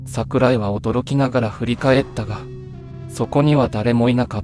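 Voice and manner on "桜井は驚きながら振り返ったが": ordinary delivery, nothing marked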